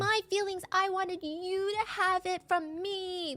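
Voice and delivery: put-on high, slow, whining like a big baby; mock him as a bratty toddler; whiny child. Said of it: "it's very like".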